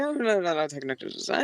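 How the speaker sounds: soft mumbled correction, fading away; mumbling. Shown as a demonstration. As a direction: mumble it under your breath. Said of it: "you gotta mumble".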